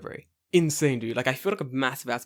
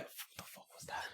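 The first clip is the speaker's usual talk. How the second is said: stunned whisper; 25 dB quieter than usual. Retold or imitated. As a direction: imitate a hushed, disbelieving aside. Retold stunned aside under breath.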